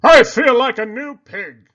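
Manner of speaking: a pig thrilled to feel fresh; joyful Poppleton. A narrator's reading. Pig proudly telling his friend, loud and delighted. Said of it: "Poppleton said to Cherry Sue".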